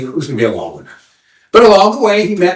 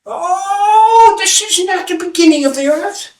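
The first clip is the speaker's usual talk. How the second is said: very high, drawn-out correction; old seer; slower than usual. Acted. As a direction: croon it high, as a knowing sage. Old man corrects angry seeker.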